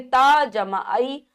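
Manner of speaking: very slow, each part held apart; slow spelling out. Shown as a demonstration. Teacher slowly spelling a syllable for children. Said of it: "इस प्रकार से होती है".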